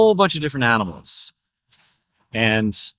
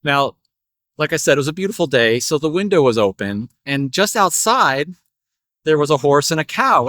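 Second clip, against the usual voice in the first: ordinary delivery, nothing marked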